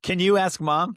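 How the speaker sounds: put-on high whiny kid voice. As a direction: beg like a child. Mimicking kid workaround.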